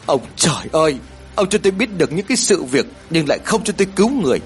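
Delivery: high-pitched railing at heaven; anguished crying out. A narrator's reading. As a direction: accuse heaven in despair, pitch climbing. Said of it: "đập đầu của mình vào cánh cửa mà gào lớn".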